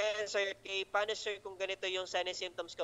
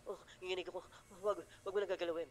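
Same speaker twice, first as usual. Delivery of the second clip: mock seizing patient; 2 semitones higher, 5 dB quieter, faster than usual. Teacher acting a seizing patient, softly pleading. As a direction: play the trembling patient begging not to be touched.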